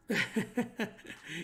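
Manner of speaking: short run of chuckles; amused laugh at a joke. Real, not acted. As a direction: crack up briefly. Host laughs at chat comment.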